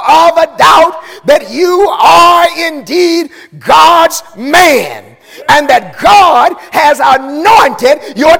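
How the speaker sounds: slow, stretched, fervent sermon cadence; preaching at full force. Acted. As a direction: lean into each phrase for the congregation. Preacher voices Nicodemus's confession.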